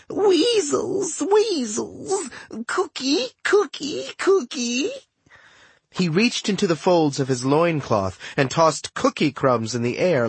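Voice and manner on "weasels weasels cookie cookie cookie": very high, rising senile babble; muttering old god. A narrator's reading. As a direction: mutter it like a doddering ancient.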